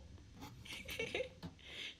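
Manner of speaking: high, soft, breathy giggling; quiet high tittering; amused giggle. Real, not acted. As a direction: giggle helplessly at your own silly joke. Giggles at own joke.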